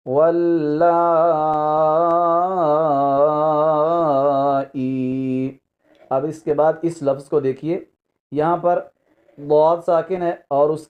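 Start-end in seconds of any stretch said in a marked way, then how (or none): 0.1-5.6 واللائی with the madd drawn out very long on لا; correct recitation. Shown as a demonstration. Teacher giving the correct reading, واللائی long and chanted. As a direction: stretch لا over several counts, then ائی. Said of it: "جیسے ہم پہلے پڑھ چکے ہیں".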